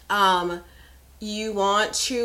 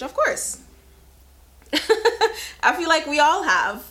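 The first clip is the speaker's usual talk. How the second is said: laughing; 7 semitones higher, 4 dB louder than usual. Real, not acted. Laughing answer to a bondage question.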